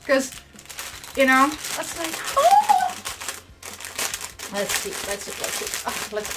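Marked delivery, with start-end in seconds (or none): 2.4-2.9 loud, very high gasp; demonstrated excited gasp; hyperventilating gasp. Shown as a demonstration. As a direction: gasp sharply as if overwhelmed. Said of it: "apologize in advance for the hyperventilation".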